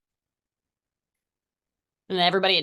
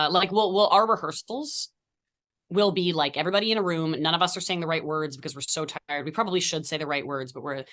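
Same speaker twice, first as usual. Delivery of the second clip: ordinary talk, nothing marked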